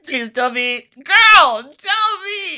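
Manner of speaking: high, loud mock begging; excited, laughing plea for gossip; playful pleading. Real, not acted. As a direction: squeal it out, demanding the gossip. Playful begging to hear the rumours.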